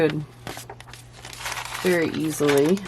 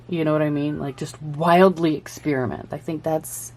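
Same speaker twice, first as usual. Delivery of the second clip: ordinary talk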